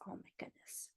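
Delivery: breathy hushed wonder; whispered aside. Real, not acted. Showing the picture to children, hushed whisper.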